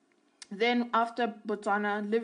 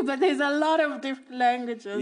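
laughing; 4 semitones higher, 7 dB louder than usual. Real, not acted. Dodging a wrong number with the host, laughing. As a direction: laugh it off, a bit louder. Catching herself on statistics.

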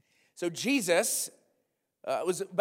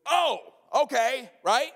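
mock acceptance; 8 semitones higher, 5 dB louder, slower than usual. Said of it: "And you're like".

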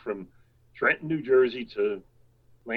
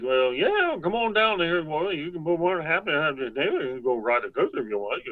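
Charlie Dinn; 4 semitones higher than usual. Retold or imitated. Coaster builder inviting friends down for a ride, teasing impersonation. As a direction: do an exaggerated impersonation, pitched well up. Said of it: "he spoke just like that".

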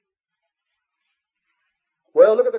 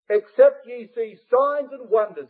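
raised, slow and weighty, leaning on signs; emphatic slowed quote; 5 semitones higher, 5 dB louder, slower than usual. Shown as a demonstration. Preacher to the congregation, raised, slow emphasis on signs. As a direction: proclaim it to the crowd, hitting signs hard.

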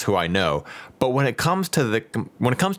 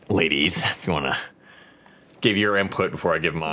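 Beetlejuice voice; 4 semitones lower than usual. Real, not acted. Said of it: "the Beetlejuice voice".

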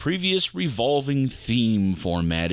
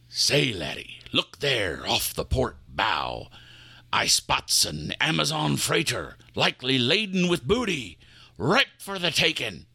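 put-on sly pirate accent, lightly done; 2 semitones higher than usual. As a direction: point out the prize in a scheming pirate accent.

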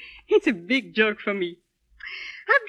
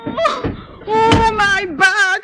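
slow, high, pained moan; 8 semitones higher, 5 dB louder, much slower than usual. Acted. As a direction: moan about your back. In pain.

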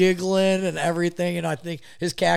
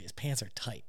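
much softer, a hushed repeat; hushed aside; 5 semitones lower, 12 dB quieter than usual. Retold or imitated. [name] repeating the tight-pants remark, hushed. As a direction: drop it to a near-hush.

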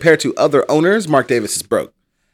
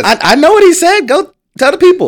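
much higher, much louder burst of exasperation; loud outburst; 7 dB louder than usual. Real, not acted. Cohost exasperated at the owner's excuse, yelling. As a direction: shout it out, fed up and incredulous.

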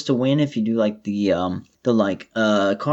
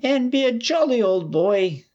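high, slow hammed-up Irish accent; over the top Irish accent; 11 semitones higher than usual. Shown as a demonstration. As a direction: ham up a stage Irish accent. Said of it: "That's the attempt at an Irish accent there".

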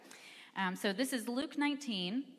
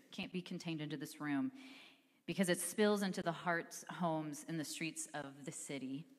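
ordinary delivery

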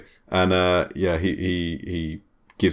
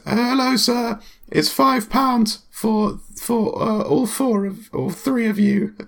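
put-on much higher, hesitant teenage clerk; gym clerk voice; slower than usual. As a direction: squeak out as the nervous acne-ridden clerk.

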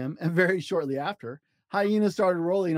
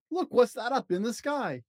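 excited cry; 7 semitones higher than usual. A narrator's reading. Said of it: "cried Mister Keeper".

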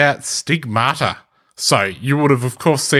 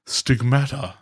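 put-on Stigmata in a light British accent, short flat a; a hint of a British expert saying Stigmata; lightly put-on British pronunciation of Stigmata; 4 dB quieter, slower than usual. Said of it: "imagine him saying that with a British accent".

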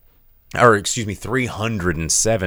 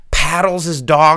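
ordinary talk, nothing marked

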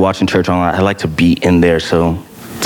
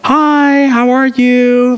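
high, slow sweet Southern hello; sweet Southern greeting; much slower than usual. Retold or imitated. Mother greeting her son's friend at church, sweet Southern hello. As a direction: greet a newcomer like a sweet Southern mom at the door. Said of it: "her nice, sweet Southern voice".

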